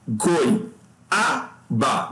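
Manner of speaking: goi, a, ba as three separated beats; syllable by syllable. Shown as a demonstration. Teacher splits goiaba to show diphthong and hiatus, syllable by syllable. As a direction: pause after each of goi, a, ba. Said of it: "como é que separa".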